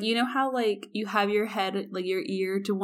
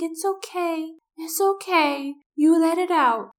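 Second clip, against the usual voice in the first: high, sing-song comforting voice; tipsy friend pats his head and comforts him; soothing drunk friend; 8 semitones higher than usual. Retold or imitated. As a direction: coo at him like a sick child. Drunk friend soothing the sick guy.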